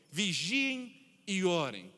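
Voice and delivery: slow, weighty "Vigiem e orem" with each word set apart; slow, grave imperative; solemn command. Shown as a demonstration. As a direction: press the imperative home slowly. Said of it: "Se trata de uma ordem".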